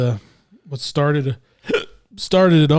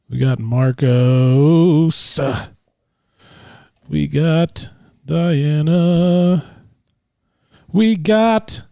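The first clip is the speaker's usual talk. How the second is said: drawn-out hype intro; 3 semitones higher, 5 dB louder, much slower than usual. Acted. Slow drawn-out podcast intro roll call.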